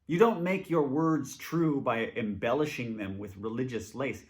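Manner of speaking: ordinary talk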